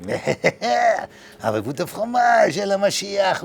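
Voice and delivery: slow, hammed-up French accent; mock French accent. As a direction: lay on a cartoon French accent, slowly. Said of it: "The French".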